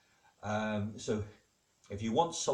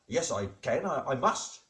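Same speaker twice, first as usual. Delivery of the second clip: louder, higher pleading insistence; insistent protest; 5 semitones higher, 5 dB louder than usual. Acted. Higgs boson argues to be let in, insistent protest. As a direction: argue back in mock urgency.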